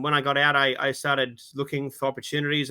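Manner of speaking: ordinary talk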